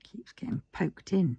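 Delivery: ordinary delivery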